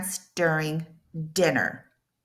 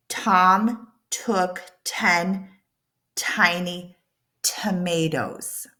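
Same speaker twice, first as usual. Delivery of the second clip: slow; much slower than usual. Shown as a demonstration. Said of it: "Notice how slow".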